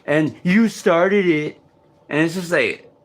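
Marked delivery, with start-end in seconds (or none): 0.0-1.6 higher, louder sneering voice taunting; mocking taunt. Imitating the voices that pick fights, sneering. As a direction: mimic the bullying voices with a sneer. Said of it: "they always pick fights with me and say".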